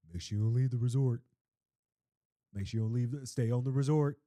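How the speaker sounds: low, brisk dad impression. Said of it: "let me do my dad voice too".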